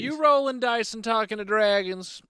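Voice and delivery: high bouncy Cosby-style voice; a hint of Bill Cosby; Bill Cosby impression. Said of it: "You almost went Cosby there".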